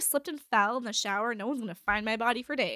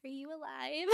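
soft, higher-pitched assistant check-in; imitated voice assistant; 4 semitones higher, 11 dB quieter than usual. Voice assistant checks on silent owner, softly imitated. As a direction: lightly mimic a device asking gently. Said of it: "where she's like".

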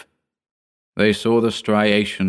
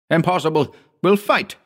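put-on defiant young assistant; 9 semitones higher than usual. A narrator's reading. Hugh defiant.

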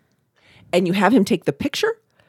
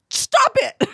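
high, sharp scolding squeal; high, startled command. Retold or imitated. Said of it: "She's like".

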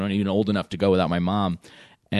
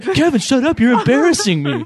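much higher, louder mock scolding mom; hammed-up mortified mother; embarrassed mom hiss; 12 semitones higher, 7 dB louder than usual. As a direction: mom scolding her son in a high, loud mock voice. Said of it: "She's like".

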